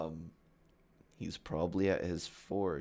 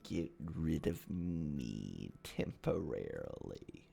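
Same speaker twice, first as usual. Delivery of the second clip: Hat Man; 7 dB quieter, slower than usual. Acted. Hat Man warning the player, slow sinking villain voice. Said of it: "The Hat Man looks over and goes".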